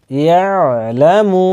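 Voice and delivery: slow, measured Quran recitation with long vowels; joined-up recitation. Shown as a demonstration. Teacher showing learners two words joined, slow measured recitation. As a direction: join the words slowly, drawing out each long vowel. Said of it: "দুইটাকে একসাথে মিলাতে".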